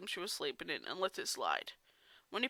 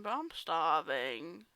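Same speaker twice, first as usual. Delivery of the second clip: slow starving moan; 4 semitones lower, much slower than usual. Acted. Fluttershy admitting hunger to Discord, slow drawn-out moan. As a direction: drag out I'm starving slowly. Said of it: "I'll redo that".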